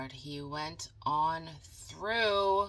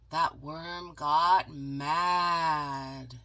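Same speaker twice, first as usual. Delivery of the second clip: usual speaking voice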